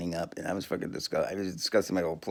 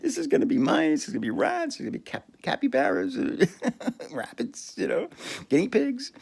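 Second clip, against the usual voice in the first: high, giggly voice listing animals; giggling; 12 semitones higher than usual. Real, not acted. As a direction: laugh through the list. Giggling while listing rodents.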